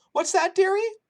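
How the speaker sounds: put-on high, loud old Polish woman's accent; kindly old woman with a thick Polish accent. A narrator's reading. Shelter cook greeting the boy in a thick Polish accent. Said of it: "in her thick Polish accent".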